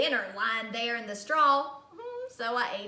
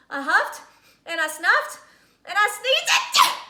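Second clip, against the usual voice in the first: huffing and snuffing, rising to a much higher, louder sneeze; building to a sneeze; 8 semitones higher, 8 dB louder than usual. Acted. Wolf huffing at the brick house, building to a sneeze.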